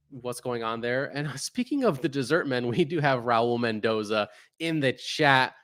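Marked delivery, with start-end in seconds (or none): none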